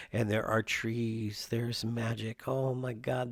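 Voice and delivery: ordinary talk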